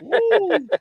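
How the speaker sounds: sudden high-pitched "woo"; high whoop. Real, not acted. Panelist reacting to a friend's roast, high whoop. Reacting to a joke about hell.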